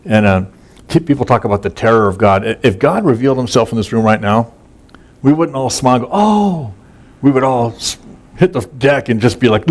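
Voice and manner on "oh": airy casual oh. Pretend. Mock casual reaction to God.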